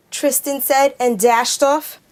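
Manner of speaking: ordinary delivery, nothing marked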